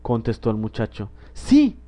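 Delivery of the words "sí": a high, bursting little yes; overjoyed yes. A narrator's reading. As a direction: squeal it with happiness. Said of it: "dijo la niña desbordante de felicidad".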